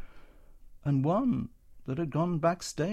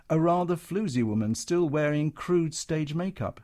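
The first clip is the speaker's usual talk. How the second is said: usual speaking voice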